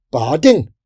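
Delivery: loud sharp call; shouting. A narrator's reading. Woman crusader casting at the fairies, shouting. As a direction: shout it like a spell. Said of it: "a woman shouted".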